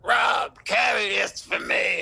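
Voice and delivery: loud, slow, bossy mock order; imitating Bob's demand. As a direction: boom it out slowly like a pushy boss. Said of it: "And he's like".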